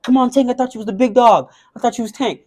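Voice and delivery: imitated goading; mock trash talk. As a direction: needle him like a rival talking trash. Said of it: "the other guy started talking shit".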